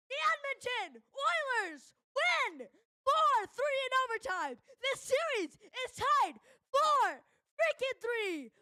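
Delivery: high, slow jubilant announcing; excited win call. Real, not acted. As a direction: celebrate the overtime win, stretched out. Celebrating overtime win.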